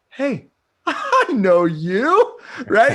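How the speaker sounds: very high, loud, slow and laughing dog voice; a squealing, laughing dog's delight; happy dog voice. Retold or imitated. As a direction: play the dog greeting an old friend. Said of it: "the dog went".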